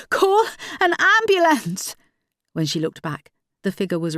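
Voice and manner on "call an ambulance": urgent shout, pitched much higher and slow. Acted. Carly shouting back to Joanne while running to the figure, urgent shout. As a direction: yell it back over your shoulder while running. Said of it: "turned her head back ... and shouted".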